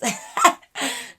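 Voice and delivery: cracking up in disbelief; laughter. Real, not acted. Laughs at being called skinny.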